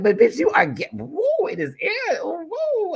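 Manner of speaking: very high hyped-up squealing; Mo Hart. Retold or imitated. Friend hyping creator's looks, high squealed whooping. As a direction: imitate a friend whooping compliments. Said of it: "she's like".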